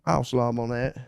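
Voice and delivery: put-on southern accent in own register; lightly voiced southern lady. Co-host imitates the Southern lady, light southern accent. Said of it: "with the Southern lady being like".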